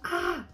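high happy shriek held back; excited squeal. Pretend. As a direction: let out a short excited squeal. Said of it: "I'm not trying to scream".

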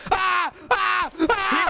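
high short scream bursts; real panicked shouts; startled yells. Real, not acted. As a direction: yelp in sudden fright. Startled by ghost in game.

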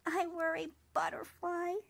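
a lightly voiced small cricket, sad and sulky; a hint of a gloomy young cricket; sulky little cricket. A narrator's reading. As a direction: pout your way through it as the little cricket.

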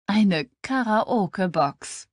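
slow, half laughing, half sighing; laugh and sigh at once. A narrator's reading. Liv admits the birthday gift, laughing and sighing. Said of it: "gleichzeitig nach einem Lachen und einem Seufzen".

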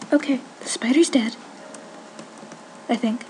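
relieved hushed whisper. Real, not acted. After killing spider.

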